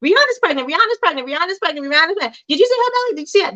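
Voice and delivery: gossiping crowd. Retold or imitated. Everybody online after the Super Bowl, chanting the news excitedly. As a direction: spread the rumor breathlessly like the whole internet. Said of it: "that's what was going on".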